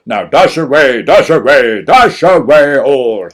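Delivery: loud, slow booming Santa calling out; rally the reindeer like Father Christmas. Acted. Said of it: "this is my Father Christmas voice".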